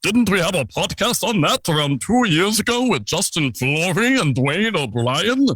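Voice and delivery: high, sing-song put-on voice; imagined listener's voice. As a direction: ask it as a silly imaginary listener. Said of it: "never use that voice again".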